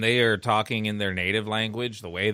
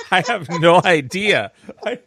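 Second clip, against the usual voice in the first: ordinary delivery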